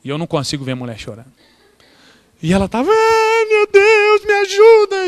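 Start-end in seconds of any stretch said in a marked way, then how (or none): 2.9-5.1 high, crying plea; lightly voiced tearful old woman; imitating her crying. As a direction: mimic a weeping old lady calling on God. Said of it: "a senhora que tava do meu lado começou a chorar".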